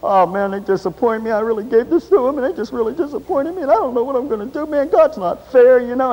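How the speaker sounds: half-sobbing imitation of a letdown believer; mock crying complaint. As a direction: play your past self moaning to God. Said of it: "I started crying about it".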